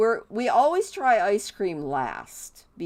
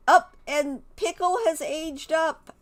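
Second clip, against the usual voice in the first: excited announcement; 8 semitones higher, 5 dB louder than usual. Real, not acted. Announcing the baby aged up.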